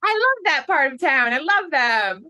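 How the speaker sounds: high put-on showbiz gush; drawn-out mock enthusiasm. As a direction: gush with exaggerated delight. Joins the performers' gushing impression.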